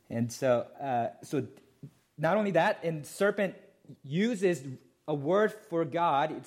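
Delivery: ordinary talk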